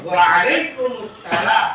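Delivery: very slow, syllable by syllable; teacher spelling out the reply slowly; slow drill. Shown as a demonstration. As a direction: stretch every syllable for the class to copy. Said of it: "ikutin yuk".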